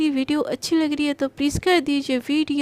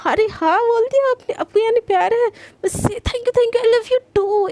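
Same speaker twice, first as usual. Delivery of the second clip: much higher, louder joyful gushing; thrilled delight; 8 semitones higher, 4 dB louder than usual. Acted. Garima, thrilled Priyanka said yes, gushes loudly and high. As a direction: burst out happily.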